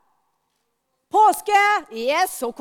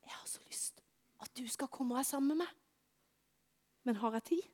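whispered plea; 3 semitones lower, 16 dB quieter than usual. Acted. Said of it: "Men så står Gud der".

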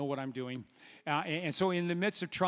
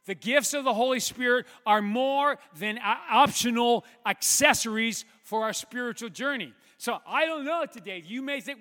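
loud, high, fervent preaching; preaching at full force; 5 semitones higher, 6 dB louder than usual. Real, not acted. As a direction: drive home the sermon's climax. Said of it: "switch into the preach mode".

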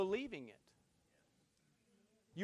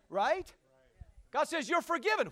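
normal talking voice

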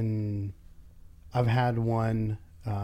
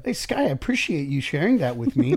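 higher, louder gushing interest; mock interested; 4 semitones higher, 5 dB louder than usual. Boss models calm reply to a critic, hammed-up interest. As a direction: lay the interest on thick. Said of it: "If I say".

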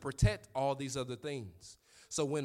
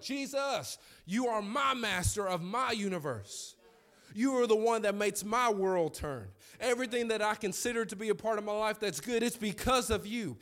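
high, loud preaching praise; impassioned prayer; 6 semitones higher, 4 dB louder than usual. Retold or imitated. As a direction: cry out to the Lord, building in fervor. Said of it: "I'm, I'm deep in".

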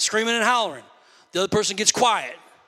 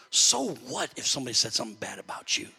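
usual speaking voice

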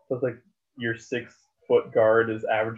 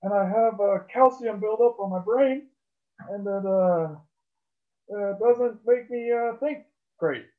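dopey, dragging mock impression of a player; Kyrie impression; 10 semitones higher than usual. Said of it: "Kyrie Irving".

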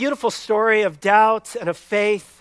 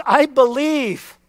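a slow, louder, higher plea from a desperate father; crying out; 5 semitones higher, 6 dB louder, slower than usual. Retold or imitated. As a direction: cry it out slowly, pleading. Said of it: "He cried out to Jesus".

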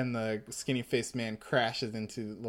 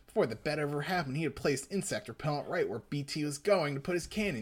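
ordinary talk, nothing marked

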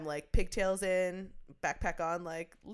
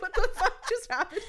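high, laughing what the fuck; laughing disbelief; 8 dB louder than usual. Retold or imitated. Retelling the throat punch, laughs in high-pitched shock. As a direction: squeal it out through laughter.

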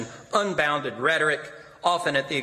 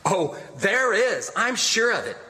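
cried out; 6 semitones higher than usual. A narrator's reading. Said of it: "cried Eugene".

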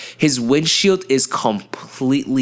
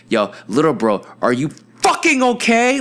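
concerned but angry; 5 semitones higher than usual. Retold or imitated. Big bro checking on drunk little bro, concerned and angry. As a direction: worry bursting into a high, angry question. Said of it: "he just expressing this weird emotion".

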